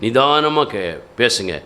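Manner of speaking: slow, careful demonstration; measured, unhurried. Shown as a demonstration. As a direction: draw out நிதானமா deliberately. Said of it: "நிதானமா எப்படி பேசணும் தெரியுமா?".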